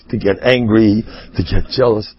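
usual speaking voice

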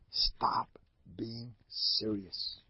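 soft unvoiced whisper. Rabbi whispering advice to his listeners. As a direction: whisper it like a secret.

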